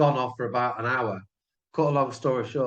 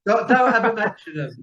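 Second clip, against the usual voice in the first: higher, louder mock-outraged protest; outraged shout; 9 semitones higher, 7 dB louder than usual. Real, not acted. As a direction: shout it down as a rival fan. Rival fan hears hated club named.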